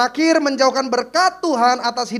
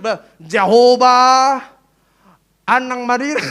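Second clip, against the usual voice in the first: slow, much louder drawn-out Jehovah; mock loud calling; 10 dB louder, much slower than usual. Pastor mimics Tapanuli worshippers crying out, much louder. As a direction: bellow Jehovah like a revival shout. Mimicking Batak prayer call.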